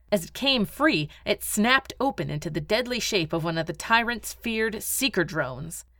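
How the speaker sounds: ordinary delivery, nothing marked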